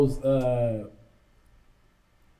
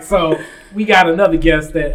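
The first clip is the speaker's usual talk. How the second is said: ordinary talk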